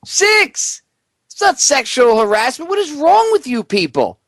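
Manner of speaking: high-pitched exasperated rant building to a yell; escalating comedy rant. Real, not acted.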